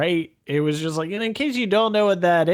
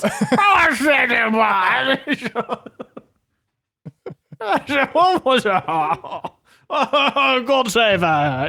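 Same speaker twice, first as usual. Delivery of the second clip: higher, slow, giggly mock British; British gent dissolving into laughs; laughing British accent; 5 semitones higher, slower than usual. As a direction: overdo the posh British through laughter. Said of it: "chokes on his own accent".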